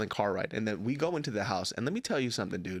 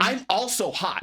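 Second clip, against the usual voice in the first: higher, indignant comeback; exasperated retort; 7 semitones higher, 4 dB louder than usual. Retold or imitated. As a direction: push back at dad, fed up.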